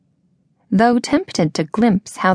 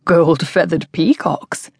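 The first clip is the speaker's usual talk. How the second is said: amused echo; 2 semitones lower, slower than usual. A narrator's reading. Hero echoes it slowly, amused.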